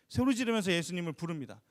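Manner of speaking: ordinary delivery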